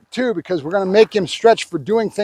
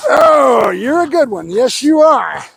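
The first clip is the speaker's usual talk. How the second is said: higher, cooing praise for a dog; 4 semitones higher, slower than usual. Real, not acted. Trainer praising his dog, higher cooing praise. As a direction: coo it to a good dog.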